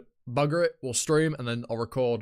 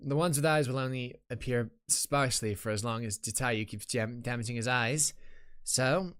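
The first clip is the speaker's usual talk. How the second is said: measured in-character reading with mild urgency at the end; lightly voiced game character. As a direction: read the game heroine's thoughts aloud, lightly acted.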